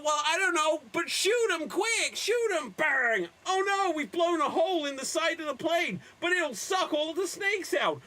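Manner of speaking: high, hurried panic; high frantic action-movie panic; frantic excitement. Acted. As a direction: scramble as the snakes attack. Frantic plane action improv.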